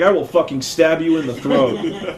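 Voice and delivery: low, measured mock threat; angry Joe. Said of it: "starts getting really angry at Justin".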